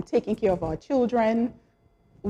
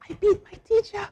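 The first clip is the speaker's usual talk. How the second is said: gleeful brag; 12 semitones higher, faster than usual. Retold or imitated. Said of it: "running joke".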